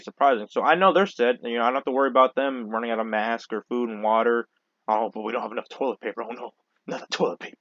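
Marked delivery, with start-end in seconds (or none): 4.9-7.6 sarcastic, hushed mock dismay; mock the hoarders softly; mock toilet paper panic. Vlogger mocking shoppers over toilet paper, quiet sarcastic dismay.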